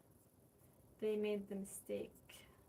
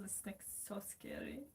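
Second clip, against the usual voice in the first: whisper; 8 dB quieter than usual. Real, not acted. Fear of kiln melting.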